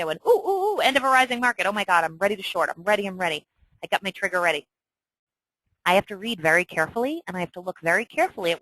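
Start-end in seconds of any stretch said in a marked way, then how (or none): 0.3-4.7 giddy trader ready to pounce; excited. Retold or imitated. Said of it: "'cause I got excited when I saw this immediately".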